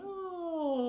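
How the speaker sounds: high sliding yawn; yawning glissando. Shown as a demonstration. Coach yawning with a glissando. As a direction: slide down through a yawn. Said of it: "do a nice glissando while you're yawning".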